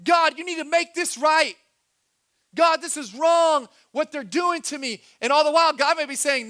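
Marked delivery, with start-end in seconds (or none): 0.0-5.0 higher, louder aggrieved complaint; a hurt believer protesting to God, aggrieved; complaining to God. Retold or imitated. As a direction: whine at God like it's unfair. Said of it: "we start saying".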